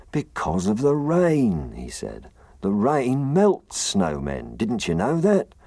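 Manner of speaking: slow, sighing gruff snowman. Acted. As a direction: sigh out the bad news slowly. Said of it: "The snowman sighed".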